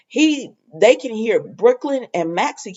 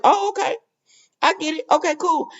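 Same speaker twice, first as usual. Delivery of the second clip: high airy voice for Brooklyn, much higher; breezy acceptance pitched up; lightly voiced Brooklyn; 10 semitones higher than usual. Brooklyn to Chase, shrugging off his refusal in a high voice.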